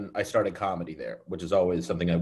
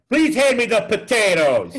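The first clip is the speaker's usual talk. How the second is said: high, loud, slow mock-polite New Yorker; polite New York accent; 12 semitones higher, 6 dB louder than usual. Joking nobody imitates New Yorkers politely, hammed-up. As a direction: gush a dinner request in a hammed-up New York voice. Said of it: "no one's like".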